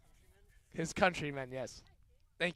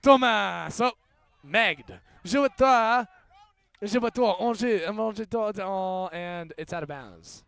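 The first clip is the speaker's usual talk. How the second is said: live play-by-play; 2 semitones higher, 8 dB louder than usual. Acted. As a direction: call the break with a mock French chant.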